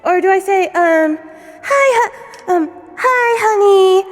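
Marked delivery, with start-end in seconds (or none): 1.6-4.0 high, stumbling hi honey; flustered sweet greeting. Pretend. Vtuber testing another hi honey take, flustered and high. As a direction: fumble a cute hello. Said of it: "like that?".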